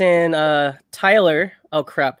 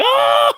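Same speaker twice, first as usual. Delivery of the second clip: high mock scream of a victim; hammed-up yelp of pain; imitated scream; 4 dB louder than usual. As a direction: scream it high, just playing. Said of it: "proceeded to scream afterwards".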